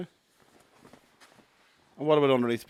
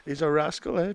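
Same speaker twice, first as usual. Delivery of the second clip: ordinary talk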